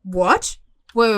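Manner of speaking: ordinary talk, nothing marked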